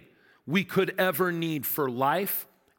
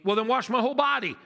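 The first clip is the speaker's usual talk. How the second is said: higher, fast eager Peter; 6 semitones higher, faster than usual. Retold or imitated. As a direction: blurt it out like an overeager disciple. Peter voiced in retelling.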